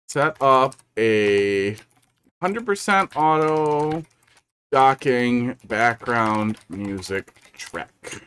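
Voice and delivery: very slow, spelled-out dictation; dictating note. Real, not acted. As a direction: say it slowly as you write. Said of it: "I'm gonna write that down".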